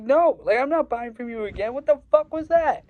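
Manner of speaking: high, loud furious yell; rising into a loud, high, furious yell; angry shout. Retold or imitated. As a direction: yell at the dealer who cheated you. Angrily cutting off the dealer.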